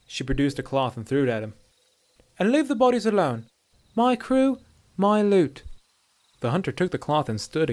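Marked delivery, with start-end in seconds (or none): 2.4-5.6 high, loud warning; raised, emphatic she-elf voice; possessive dark elf woman. A narrator's reading. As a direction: stake your claim to the loot. She-elf warns off the hunter.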